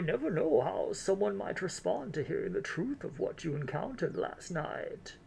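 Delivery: drawn-out elderly lady, put on thick; grandma voice. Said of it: "my grandma voice".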